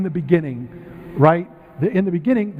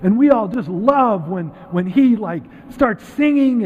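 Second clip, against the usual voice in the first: lightly acted, higher-pitched family gushing; gushing relatives; 6 semitones higher than usual. Family praising a singer in a backstory, mildly gushing. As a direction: talk up the singer like a proud friend. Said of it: "family or friends, and they're talking about how".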